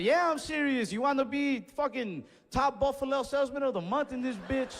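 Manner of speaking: mock hype. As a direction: hype him up sarcastically.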